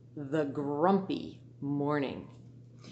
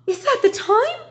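loud, high alarmed cry; a farmer shocked at the late hour; 12 dB louder than usual. Acted. Teacher voicing the oversleeping farmer, crying out loud and high. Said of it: "she said with a cry".

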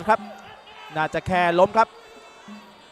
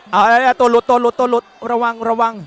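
loud urgent ringside warning; excited shouting; 4 semitones higher, 8 dB louder than usual. Real, not acted. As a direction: yell the warning fast and urgent. Live fight, urgent warning.

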